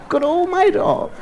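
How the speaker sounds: high, slow, laughing praise; gleeful praise. Real, not acted. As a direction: savor the name like a treasure.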